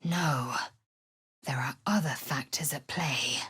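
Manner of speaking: soft whispered borrowed voice; whispered imitation of Ragslin; Ragslin's voice. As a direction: speak as Ragslin, hushed. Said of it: "With Ragslin's voice".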